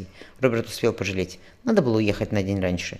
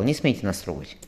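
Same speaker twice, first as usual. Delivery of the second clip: usual speaking voice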